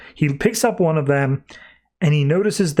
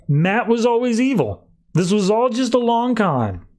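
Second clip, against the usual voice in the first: much higher, thrilled; excited reveal; 9 semitones higher than usual. Real, not acted. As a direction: gush about the twist. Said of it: "reviewer excited by the reveal".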